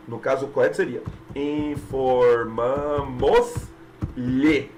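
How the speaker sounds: "informamos-lhe" said very slowly, no S; deliberate model answer; slow correct form. Shown as a demonstration. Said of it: "ficou errado, ficou esquisito".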